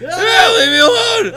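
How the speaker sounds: high, loud mock wail; mock crying. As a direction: wail it like a tormented creature. Voicing a pained creature, crying.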